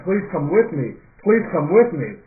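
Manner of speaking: retold plea, rising in force; pleading. Dreamer asks fiance to come with him into heaven, pleading.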